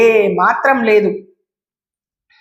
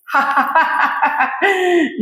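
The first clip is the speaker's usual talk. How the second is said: villain laugh; 6 semitones higher than usual. Acted.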